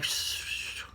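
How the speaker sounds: soft unvoiced shushing; whispering. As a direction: hush them like a secret. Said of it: "that's whispering".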